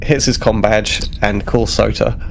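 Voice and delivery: ordinary talk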